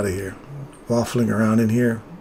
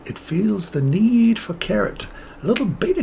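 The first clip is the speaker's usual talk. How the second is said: playful high voice; 9 semitones higher than usual. Pretend. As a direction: stretch it out in a silly high voice. Playful carrot voice repeat.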